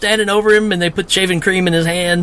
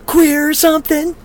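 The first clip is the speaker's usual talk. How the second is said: mock Judy; 10 semitones higher than usual. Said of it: "where Judy goes".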